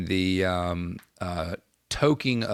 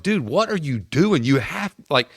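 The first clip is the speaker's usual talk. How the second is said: frustrated captain venting at a stoned player; retold exasperated scolding; 5 semitones higher than usual. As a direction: scold a clueless teammate in disbelief. Scolding dazed teammate.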